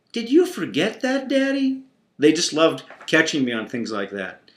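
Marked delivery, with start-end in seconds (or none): 0.1-1.8 teasing grin. Retold or imitated. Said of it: "big grins".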